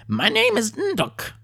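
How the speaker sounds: squeaky little chief puffing himself up; kobold chieftain. A narrator's reading. Kobold chief introducing himself to the adventurers, high and pompous. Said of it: "said the kobold with the helmet".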